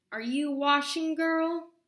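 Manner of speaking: loud, high impatient call; calling through door. Acted. Witch calling to Sasha through the door, loud and impatient.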